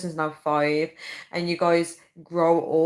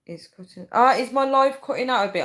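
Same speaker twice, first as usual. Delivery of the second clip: ordinary talk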